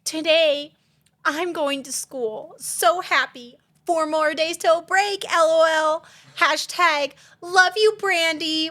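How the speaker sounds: put-on high, slow, gushing teenage voice; a giddy teen reading her old status aloud; teen girl post. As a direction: read it like your own high-school post. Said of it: "It's like".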